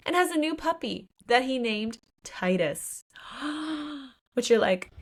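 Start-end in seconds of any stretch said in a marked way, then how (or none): 3.3-4.0 soft gasp of recognition; acted gasp. Reader hears the puppy's name, gasps in recognition. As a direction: gasp as if spotting a clue.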